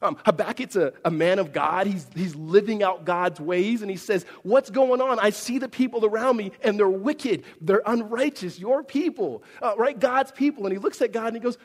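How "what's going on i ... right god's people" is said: raised, higher, indignant prophet complaining; demanding answers of God, louder. Retold or imitated.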